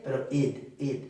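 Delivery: "id" said as a short clear id; id not ed. Shown as a demonstration. Teacher correcting the ending, saying id. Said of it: "No ed".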